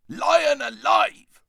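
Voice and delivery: loud, high, drawn-out dwarf roar. Acted. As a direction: bellow it out slowly like a startled dwarf. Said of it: "roared the dwarf".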